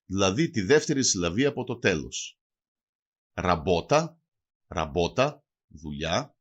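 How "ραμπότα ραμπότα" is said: "Ραμπότα" with the weight on μπό; penultimate stress. Shown as a demonstration. Teacher shows dialect stress on the second-last syllable, "Ραμπότα" stressed on μπό. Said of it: "τονίζουν συνήθως στην παραλίγουσα".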